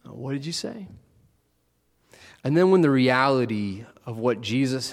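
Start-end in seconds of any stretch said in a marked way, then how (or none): 0.0-0.9 soft, unsure, nervously laughing; a shaken little laugh, asking him to repeat. Retold or imitated. Peter nervously asking Jesus what he said. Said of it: "Peter sort of nervously laughing".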